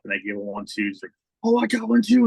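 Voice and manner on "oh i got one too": high, loud delighted squeal; thrilled kid. Retold or imitated. A kid who just got his own card, high and delighted.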